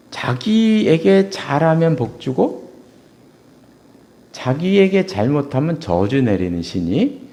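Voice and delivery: each word laid out slowly; slow emphasis. Shown as a demonstration. Preacher defining the ancestor god to the congregation, slowly. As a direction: spell it out slowly for them. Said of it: "조상신은 이런, 이런 신이에요".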